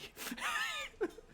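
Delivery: high, cackling laugh at his own story; real laugh after telling the drill sergeant story; burst of laughter. Real, not acted. Speaker cracks up.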